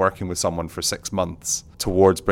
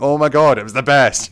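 higher and louder, bubbling over; gushing delight; 7 semitones higher, 8 dB louder than usual. Retold or imitated. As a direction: rave like you just saw the best show. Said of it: "And I was going".

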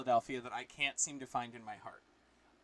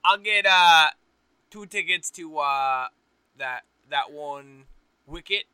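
mock Philly accent; 9 dB louder than usual. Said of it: "impersonation ... offensive".

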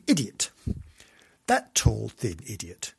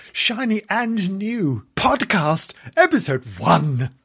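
slow, loud, higher-pitched title announcement; announcer hype; 6 semitones higher, 7 dB louder, slower than usual. Acted. Host trumpets the first episode of a new podcast, announcer hype. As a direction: draw out the show title with big fanfare. Hammed-up repeat of show title.

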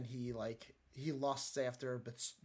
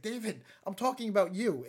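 louder, higher, emphatic prophet; pointed rebuke; 6 semitones higher, 6 dB louder than usual. Retold or imitated. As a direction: call him out firmly. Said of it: "He's like".

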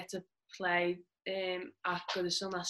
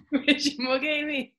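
laughing; 7 semitones higher, 8 dB louder, slower than usual. Real, not acted. Echoes question through laughter.

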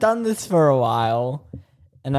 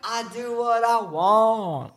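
bratty defiance; 7 semitones higher, slower than usual. Pretend. Defiant joking banter.

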